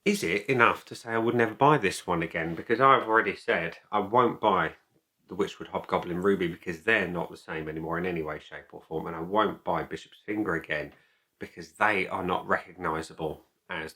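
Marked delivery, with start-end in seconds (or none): none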